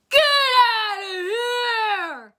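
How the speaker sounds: high drawn-out scream, nearly cracking. Retold or imitated. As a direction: scream it slowly at the top of your voice. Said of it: "he then screams".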